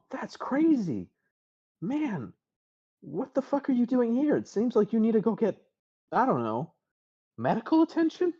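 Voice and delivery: high, teasing mock concern; joking friend. [name] joking to the shaken Podzin, high teasing voice. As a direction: tease him with mock worry. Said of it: "She's kind of making a joke".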